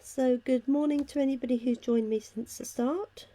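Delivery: ordinary delivery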